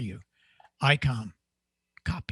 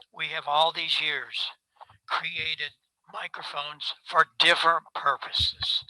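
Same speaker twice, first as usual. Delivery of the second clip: usual speaking voice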